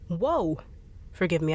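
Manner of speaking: ordinary delivery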